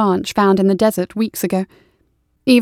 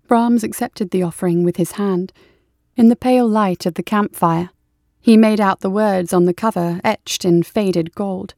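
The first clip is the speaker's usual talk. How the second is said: usual speaking voice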